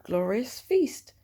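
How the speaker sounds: ordinary delivery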